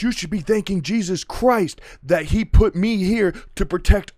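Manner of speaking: high, loud godlike tirade; talking like God. Retold or imitated. Homelander lecturing the crowd like a god, loud and high. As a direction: proclaim it as if you were a god. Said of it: "kind of talking like he's God".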